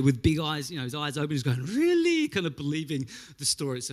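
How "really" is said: wide-eyed, much higher and louder; amazed. Retold or imitated. The uncle marvels at the Windex story, high amazed. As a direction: gasp it out in wonder.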